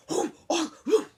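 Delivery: loud, high snarling challenge; snarled. Acted. Big dog snarls at the stray dog, loud and hostile. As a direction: snap at the stranger like a guard dog. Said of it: "he snarled".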